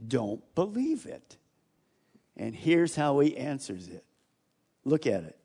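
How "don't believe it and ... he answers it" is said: usual speaking voice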